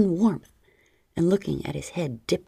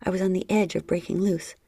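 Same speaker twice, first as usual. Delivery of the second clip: ordinary delivery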